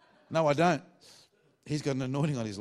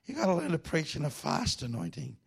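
raised, put-on old pastor voice; Clark Taylor; 4 semitones higher than usual. Old pastor urging his trainee to preach fast, mimicked high. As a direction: imitate the old cowboy mentor, pitched up. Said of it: "he talks like this".

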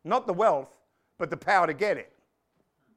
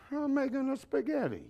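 higher, softer mock Italian papa; 7 semitones higher, 5 dB quieter than usual. Papa answering his son in the commercial, mock Italian. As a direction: answer as a hammed-up Italian dad. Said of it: "He says".

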